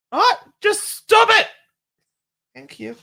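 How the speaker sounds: high, loud playful order; mock scolding. Telling a viewer to quit apologising, mock scolding. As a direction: tell them off in exaggerated exasperation.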